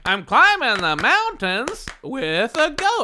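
high, very slow campy singer impression. Shown as a demonstration. Host demonstrating an answer for the guest, rhythmic impression. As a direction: holler it in long, high beats. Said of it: "an impersonation of [name]".

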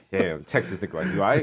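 a quiet mock mutter; hushed aside. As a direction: mutter it low to yourself. Imitating Uber rider whisper.

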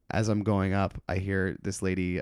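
ordinary delivery

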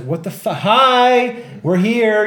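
loud, high mock call for attention; exasperated calling out. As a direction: act out hailing people who ignore you. Acting out ignored detainees' exasperation.

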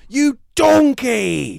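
high, slow screamed insult; Gordon Ramsay impression. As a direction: bellow it like a furious TV chef. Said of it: "Is the sergeant played by Gordon Ramsay?".

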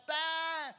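loud, high imitated bleat; sheep bleat. Lost sheep answers the shepherd, bleating. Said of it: "And it's like".

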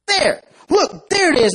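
high, relieved cry; relieved ant. Acted. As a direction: spot it with relief. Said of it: "completely freaks out".

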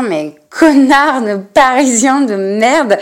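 slow mock outburst in a put-on voice; imagined angry curse. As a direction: play the fed-up comedian cursing backstage. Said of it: "il a dû dire".

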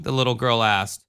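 plain reading voice

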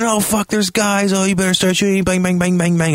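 high frantic alarm then mock gunfire; high-pitched game panic with bang sounds; panicked excitement. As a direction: scramble as enemies appear. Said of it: "And then".

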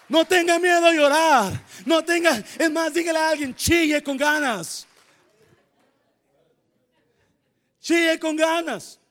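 loud, high shouted preaching; full-force preaching. Real, not acted. Pastor shouting hope to the congregation, fervent preaching. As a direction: cry it out to the church at full force.

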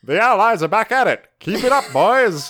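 rally the troops like a 1940s radio announcer; wartime announcer. Pretend.

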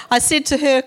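ordinary talk, nothing marked

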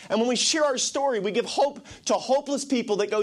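high, fervent preaching climb; rally the church with rising fervour; preaching at full force. Acted. Preacher urging the congregation to go back, fervent.

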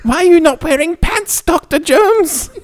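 very high squeaky mock boy; mock kid voice. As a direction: squeak it like an innocent scout. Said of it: "mocking kid's voice".

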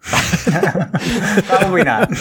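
high, drawn-out words through laughter; laughing. Real, not acted. Co-host admitting he can't remember, really laughing. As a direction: crack up while saying it.

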